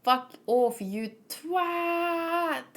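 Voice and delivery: cheeky put-on voice telling someone to get lost; mock Reiner. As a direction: toss off a rude insult in a put-on voice. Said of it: "Reiner be like".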